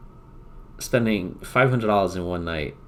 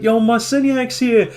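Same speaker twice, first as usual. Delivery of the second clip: buddy hyping arrival; 13 semitones higher, 6 dB louder than usual. Retold or imitated. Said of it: "He's like".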